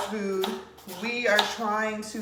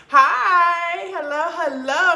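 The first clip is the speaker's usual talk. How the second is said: excited greeting; 9 semitones higher, 7 dB louder, slower than usual. Real, not acted. Greeting live viewers.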